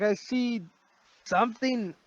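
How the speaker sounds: high, held-back yell; high-pitched acted outcry; mock yelling. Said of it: "He's kinda yelling".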